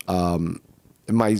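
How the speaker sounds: ordinary delivery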